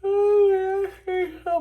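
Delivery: words stretched through a yawn. Real, not acted. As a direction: say it mid-yawn, high and loud. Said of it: "Sorry f-, for the yawn".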